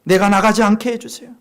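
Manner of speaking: 점점 높고 크게 간청; 다급히 매달리듯 부르짖기; 간절한 기도. Acted. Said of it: "기도합니다". (목청 높여 간구)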